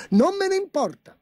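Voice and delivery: high petulant outburst; petulant emperor. A narrator's reading. Emperor brushing off the chancellor, high and petulant. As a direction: toss it off like a spoiled child.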